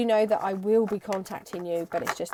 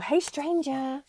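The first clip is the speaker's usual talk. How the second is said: slow, higher drawn-out hey stranger; mock creepy stranger greeting; 6 semitones higher, slower than usual. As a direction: lean into a creepy hello. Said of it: "That sounded really creepy".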